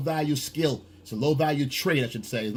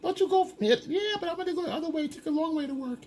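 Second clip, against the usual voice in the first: put-on high falsetto girl talk; woman's voice; faster than usual. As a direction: imitate women chatting, pitched way up. Voices women chatting on way to work.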